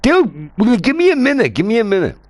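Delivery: high, loud, exasperated plea. Real, not acted. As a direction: beg for a moment, exasperated. Pleading with friend.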